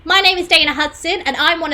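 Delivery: loud, high, drawn-out greeting; slow, ringing MC hello; show host welcome. Real, not acted. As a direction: open the show with a big, projected welcome. Host opens live theatre show.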